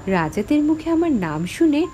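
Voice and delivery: usual speaking voice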